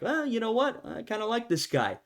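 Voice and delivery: high, casual grudging approval. Retold or imitated. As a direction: play a peasant warming to the king. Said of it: "People are like".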